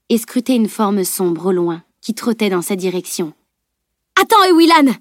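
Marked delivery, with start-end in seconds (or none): 4.2-4.9 high, loud urgent call; urgent raised-voice warning; crying out. A narrator's reading. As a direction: shout after someone to stop her. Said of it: "s'écria Edwin".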